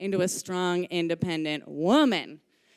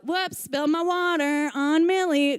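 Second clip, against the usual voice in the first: high, raised announcement; acted yell; 6 semitones higher, 3 dB louder than usual. Teacher covering for a pupil's accident, yelling. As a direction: call it out as a staged accident.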